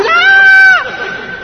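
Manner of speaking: very high, long mock scream; boy's scream. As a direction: scream like a fleeing little boy.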